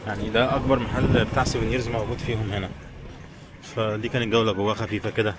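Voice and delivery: ordinary talk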